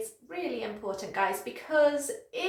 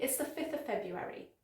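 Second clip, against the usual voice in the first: soft, quicker run through the tongue twister; quick whole sentence; 6 dB quieter than usual. Shown as a demonstration. Teacher speeds up a tricky date, quick delivery. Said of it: "The whole sentence quickly".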